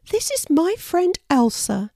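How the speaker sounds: high-pitched storybook character; high animal-mum character voice; introducing mother wallaby. A narrator's reading. Wanda introduces her friend.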